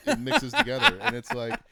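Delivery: giddy about the gadget; laughing. Real, not acted. Delighted by the gadget.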